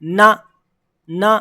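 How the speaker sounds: ना said loud and short; clipped na sound; phonic n sound. Shown as a demonstration. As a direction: drill ना twice. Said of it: "इसको क्या पढ़ते हैं".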